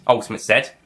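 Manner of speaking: usual speaking voice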